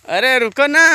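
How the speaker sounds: loud, high call to wait. Real, not acted. Vlogger to baby walking ahead, calling out to stop.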